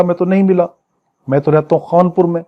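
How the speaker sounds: ordinary talk, nothing marked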